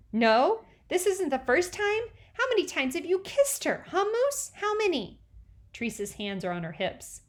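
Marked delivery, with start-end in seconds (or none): 0.1-5.2 put-on high, pushy child voice; little sister demanding answers; interrogating little girl. A narrator's reading. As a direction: grill him like a nosy kid sister. Teresa interrogates Moose.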